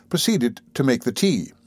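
plain reading voice

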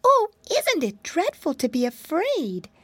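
put-on high, fretful little girl voice; a tiny frightened mouse wailing; timid mousy girl. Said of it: "he heard the voice saying".

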